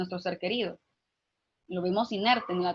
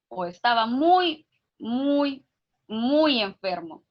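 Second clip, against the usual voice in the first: each "muy" drawn out and leaned on, slower; stress on muy; slower than usual. Shown as a demonstration. Telling a child a relative died, stressing "muy". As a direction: hammer "muy" so the child grasps how sick. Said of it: "hay que recalcar tanto esta palabra".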